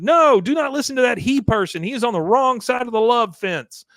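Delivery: high, loud commanding cult leader; shrill cult leader. Retold or imitated. Cult leader calling the women back, high and loud. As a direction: order them back in a high voice.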